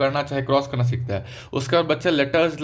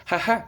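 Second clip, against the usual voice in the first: high two-beat mock laugh; imitated baby laugh; 6 semitones higher than usual. Teacher recapping that baby laughs next, mock laugh. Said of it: "बच्चा हंसेगा".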